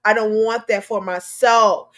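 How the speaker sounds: ordinary talk, nothing marked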